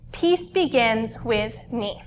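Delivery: slow, soft mantra; calm mantra. Shown as a demonstration. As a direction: teach the phrase slowly and gently. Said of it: "I wanna teach you guys".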